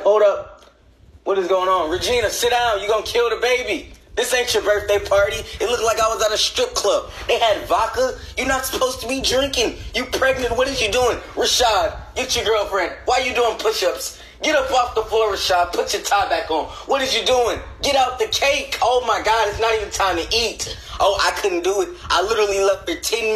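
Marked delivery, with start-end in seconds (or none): 0.0-5.4 mock scolding. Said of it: "I'm like". 8.4-20.8 mock scolding. Scolding partiers at baby shower.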